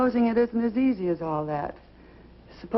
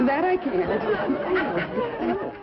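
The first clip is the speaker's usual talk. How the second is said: a wail dragged out slowly; slow, drawn-out despair; 8 semitones higher, much slower than usual. Acted. Wife despairing over telling husband, very slow and high. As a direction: stretch every word out, much higher.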